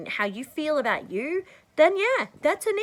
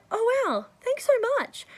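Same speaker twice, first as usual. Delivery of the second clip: high, pleased voice of a stranger thanking her; a stranger caught off guard by a compliment; surprised stranger; 13 semitones higher than usual. Retold or imitated. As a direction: play the flattered passer-by, lightly. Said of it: "And they'll go".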